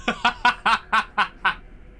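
high staccato burst of laughter; long, delighted ha-ha-ha; gleeful cackle. Real, not acted. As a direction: cackle at your own prank. Laughs at own trolling idea.

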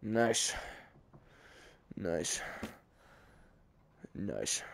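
very low, soft murmur; quiet low approval. Real, not acted. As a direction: drop it deep and murmur to yourself. Repeats nice ever lower to himself.

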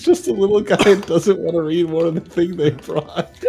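talking through laughter; giggling out the words; laughing. Real, not acted.

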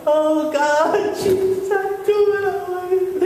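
high, drawn-out cry of greeting; emotional pastor. Acted. Pastor greeting God from the front row, excited. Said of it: "the pastor's up on the front row going".

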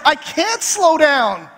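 high, drawn-out mock wail; mock exasperated. Wife refusing husband's advice on the phone, mock exasperated. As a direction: imitate a stubborn wife, stretching every word.

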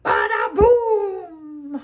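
loud slow thundering badaboum; booming yell. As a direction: shout it like a thunderclap. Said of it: "Et le pingouin hurla".

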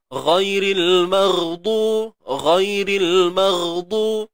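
ghairil maghdu slow with gh from the throat; slow, thick gh from the back of the throat; correct throaty ghain. Shown as a demonstration. Teacher showing the correct ghain. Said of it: "Huruf ghain ni peranan dia ni".

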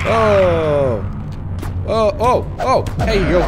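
loud high alarmed cries; startled shouting. Real, not acted. Surprised by shadow people.

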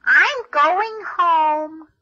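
drawn out slowly as the fly; fly's voice. Acted. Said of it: "says the fly to the spider".

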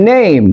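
নেম as "neym", long gliding vowel; native pronunciation. Shown as a demonstration. Showing what natives say, নেম with an "ey" glide. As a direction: stretch নেম into "ne-im". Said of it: "তারা কি বলে?".

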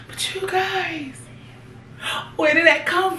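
drawn-out high "you guys" then a startled question; reacting to a rip she just noticed; surprised dismay. Real, not acted. Vlogger showing viewers the torn jumpsuit, surprised.

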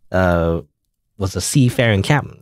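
ordinary talk, nothing marked

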